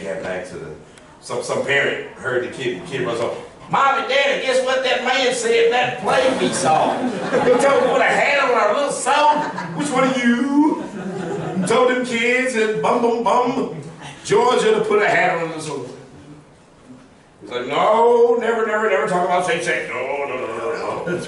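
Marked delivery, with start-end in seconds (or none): 3.7-9.5 put-on tattle like an excited little kid. Kid voiced in story. 9.8-15.9 high, slow angry parent. Retold or imitated. 17.7-21.1 emphatic no. Retold or imitated. Principal forbidding, fading.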